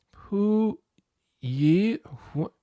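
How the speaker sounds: halting "Pu Yi Hu-" held too high; wrong high tones on "Pu Yi"; botched tones. Acted. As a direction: attempt "Pu Yi" syllable by syllable, high and strained-out. Pupil botches the tones, gets corrected.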